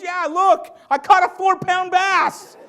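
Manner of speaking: high, bright, excited boast; proud daughter. Retold or imitated. Daughter to worried dad about her fish: high excited boast. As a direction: show off your catch, thrilled.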